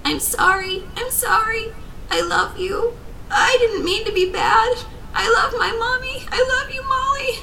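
very high, sobbing pleas; desperate, tearful kid voice; child crying out. A narrator's reading. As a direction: wail apologies like a little girl. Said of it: "I heard the kid cry out".

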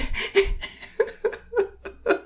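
high breathless sob; crying sob after confessing fear. Acted. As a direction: break down into sobs. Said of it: "broken as her eyes gushed over with tears".

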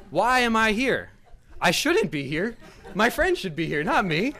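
loud, high complaint; mock exasperation. Guest to himself getting water, mock exasperation. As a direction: protest loudly at being stuck here.

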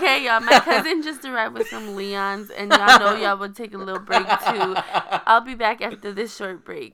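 loud, laughing sign-off to a break; laughing announcement. Pretend.

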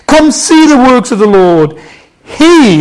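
loud, high scripture proclamation; ringing pulpit reading; impassioned preaching. Real, not acted. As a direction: proclaim the psalm to the congregation. Preaches the psalm at full force.

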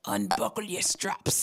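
slow, drawn-out impersonation; slow mock seduction; imagined celebrity voice. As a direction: stretch it out as his supposed voice. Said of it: "the way I think he sound".

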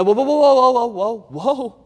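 high, loud calling out to stop the crowd; urgent whoa. Real, not acted. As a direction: rein the room in, keep them seated. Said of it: "don't close your Bibles".

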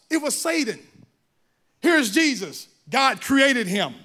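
normal talking voice

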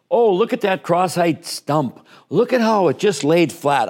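oversell a routine save to the fans; embellished color commentary. Shown as a demonstration. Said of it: "I was embellishing everything".

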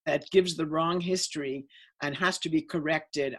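ordinary talk, nothing marked

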